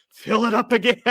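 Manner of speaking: loud, high eager demand; Will Ferrell. Retold or imitated. Quoting the bong scene to co-hosts, high loud call. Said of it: "the way he delivers that line".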